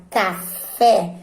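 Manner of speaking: CAFÉ said slowly with FÉ punched; stress on the last syllable. Shown as a demonstration. Teacher showing the class the strongest syllable, stressed FÉ in café. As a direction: lean hard on the FÉ of café. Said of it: "o fé é o mais forte".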